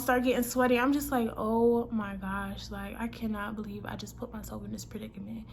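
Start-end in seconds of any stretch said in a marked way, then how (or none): 1.4-5.5 hushed, acted dismay; dismayed inner thought. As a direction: say it to yourself in quiet disbelief. Acted nervous thoughts to herself.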